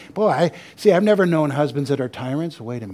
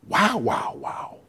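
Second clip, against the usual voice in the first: excited Adam; slower than usual. Retold or imitated. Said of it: "See, he's excited".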